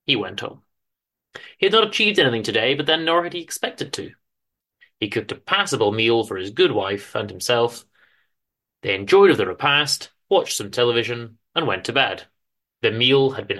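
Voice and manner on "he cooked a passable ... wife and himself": plain reading voice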